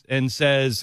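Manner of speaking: ordinary talk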